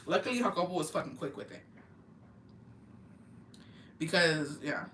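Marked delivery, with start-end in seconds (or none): none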